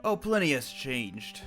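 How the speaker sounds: normal talking voice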